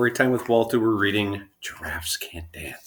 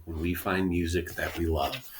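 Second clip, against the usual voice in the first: much softer, tender ending; soft, tender close; 3 semitones lower, 8 dB quieter than usual. A narrator's reading. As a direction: hush it gently like a lullaby. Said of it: "he said".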